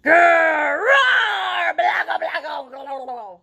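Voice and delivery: loud growl tumbling into monster babble; playful storytime monster noise; scary monster roar. Acted. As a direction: roar to scare a child, easing off at the end. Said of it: "I'm gonna be a little scary".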